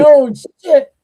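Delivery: loud, high "Oh, shit" with a rising end; shocked. Retold or imitated. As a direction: gasp it out in disbelief. Shocked reaction to the twist.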